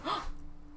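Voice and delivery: breathy startled gasp; sharp gasp. Acted. Luca seeing a shape, startled gasp. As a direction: catch your breath in fright.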